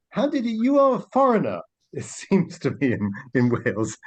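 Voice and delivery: normal talking voice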